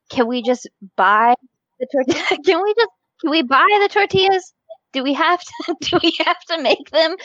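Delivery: high, pleading whine about the tortillas; high, whiny plea over homemade tortillas; desperate plea. Retold or imitated. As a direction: beg to skip the work, half laughing.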